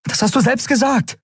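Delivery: high-pitched, heated accusation; agitated, pitched well up; angry outburst. A narrator's reading. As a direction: blurt it out accusingly. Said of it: "platzte es aus ihr heraus".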